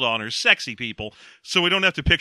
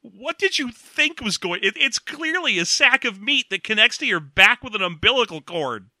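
higher, baffled disbelief; incredulous; 7 semitones higher than usual. Retold or imitated. A viewer scolding the clueless character, incredulous. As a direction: ask it like it's blindingly obvious. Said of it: "And I was like".

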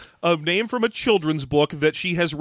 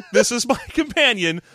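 ordinary talk

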